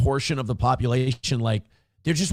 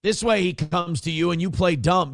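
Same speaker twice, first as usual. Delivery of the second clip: ordinary talk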